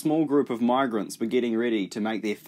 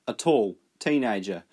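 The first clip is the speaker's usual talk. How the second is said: ordinary delivery, nothing marked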